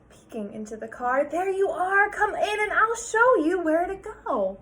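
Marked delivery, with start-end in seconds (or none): 1.2-4.6 higher, louder friendly principal; welcoming principal. Acted. Principal welcoming Sarah into school, higher and friendly. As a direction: welcome her in.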